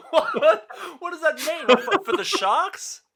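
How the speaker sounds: high-pitched laughing disbelief. Real, not acted. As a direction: squeal it through laughter.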